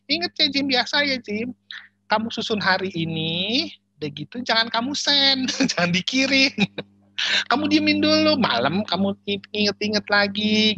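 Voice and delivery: high, loud mock yell of the boss's wife; imitated yelling. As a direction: nag loudly up high, stretching phrase endings, half laughing. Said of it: "istri bosnya selalu teriak gini". (menirukan istri bos berteriak)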